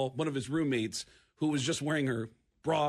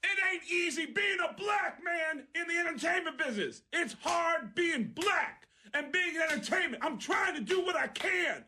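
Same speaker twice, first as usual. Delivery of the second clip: high shouted rant; mock angry yell, pitched way up; retold angry yelling; 12 semitones higher than usual. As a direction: shout it at the whole club, much higher. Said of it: "starts yelling in the middle of the club".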